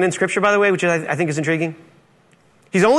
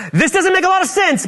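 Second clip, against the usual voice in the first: high-pitched, comic bewilderment; incredulous objection; 11 semitones higher than usual. Acted. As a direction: protest in a high, baffled voice. Ananias voiced objecting to God.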